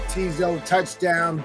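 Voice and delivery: ordinary talk, nothing marked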